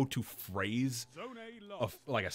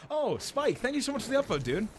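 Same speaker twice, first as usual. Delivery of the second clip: excited thanks; 11 semitones higher, 3 dB louder than usual. Real, not acted. Thanking a viewer on stream.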